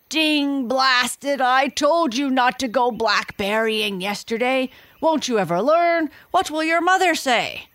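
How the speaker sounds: high, loud scolding lion, raising it to a yell; angry roaring lion. A narrator's reading. Lion caught in blackberries scolds himself in a high, loud yell. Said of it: "an angry animal roaring".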